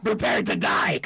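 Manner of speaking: loud battle cry; shouted threat. Pretend. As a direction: bellow it at a sworn enemy. In-character threat, repeated shouted.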